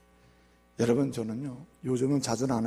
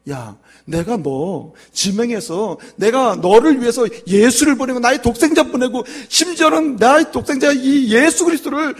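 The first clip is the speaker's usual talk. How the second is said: high, loud pleading divine voice; voicing the Lord; 11 semitones higher, 13 dB louder than usual. God speaking to his child, loud pleading. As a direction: cry out God's love as the Lord himself. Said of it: "주님은 말씀하시면".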